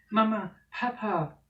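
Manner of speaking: high, loud desperate call; desperate cry. Acted. Children in the net crying for parents, desperate. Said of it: "rufen die beiden".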